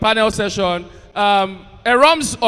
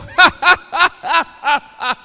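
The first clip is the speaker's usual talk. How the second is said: loud millennial belly laugh; big hearty laugh; 5 semitones higher, 6 dB louder than usual. Retold or imitated. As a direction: laugh out loud, full and free. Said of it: "We go".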